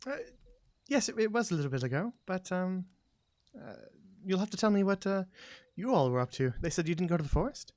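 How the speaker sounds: lightly voiced tentative townswoman; politely probing, a little unsure; hesitant character. Game master plays a character.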